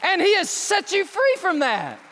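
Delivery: loud, high, fervent preaching; full-force preaching. Acted. Preacher rejoicing over a healed man, loud fervent preaching. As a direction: preach it out to the pews.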